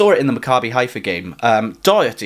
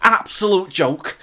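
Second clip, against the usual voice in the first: higher, slow, badly faked Scouse; botched Scouse accent; 6 semitones higher, slower than usual. Co-host repeating the pundit's verdict, slow botched Scouse accent. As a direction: drag it out in a poor Scouse accent. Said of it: "I'm not sure what accent that was".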